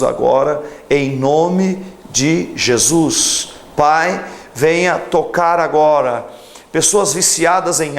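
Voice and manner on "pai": usual speaking voice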